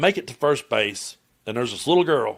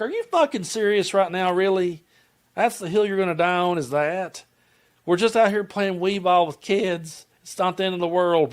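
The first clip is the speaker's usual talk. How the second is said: higher-pitched incredulous rant; exasperated comeback; 6 semitones higher than usual. Retold or imitated. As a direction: give them the what-are-you-doing rant. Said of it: "I wanted to turn around and be like".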